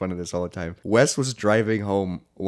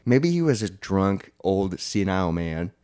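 ordinary talk

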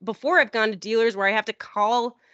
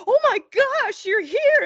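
very high, louder thrilled gasp; excited squeal; 4 dB louder, slower than usual. Retold or imitated. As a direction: squeal like starstruck fans. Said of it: "they were like".

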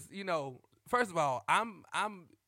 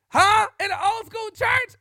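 high, loud disbelief; can you believe it; incredulous shout; 14 dB louder than usual. Real, not acted. Telling viewers about church battles, incredulous shout.

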